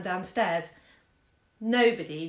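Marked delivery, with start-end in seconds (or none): none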